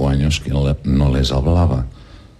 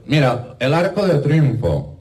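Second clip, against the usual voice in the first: excited guide; 10 semitones higher than usual. Acted. Man shows duck landmarks.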